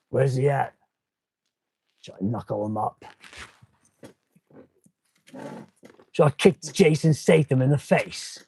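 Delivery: lightly voiced tough-guy action hero; a hint of movie hard-man menace, low on the threat; Jason Statham impression. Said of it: "That's my Statham impersonation".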